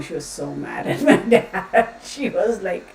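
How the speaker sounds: laughing through the words; amused laughing. Real, not acted. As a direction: break into laughter while telling the story. Amused retelling of parents' fight.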